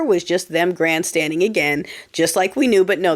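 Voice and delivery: usual speaking voice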